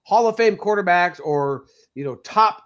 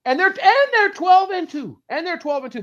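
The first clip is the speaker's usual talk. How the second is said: high, excited mock fan; loud imagined fan amazement; amazed; 9 semitones higher, 4 dB louder than usual. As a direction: gush over the record like an impressed fan. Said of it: "people would be like".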